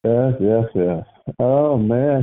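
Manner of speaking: normal talking voice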